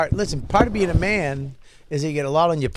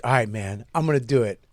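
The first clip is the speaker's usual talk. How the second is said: determined friend; 6 semitones lower than usual. Retold or imitated. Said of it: "He was like".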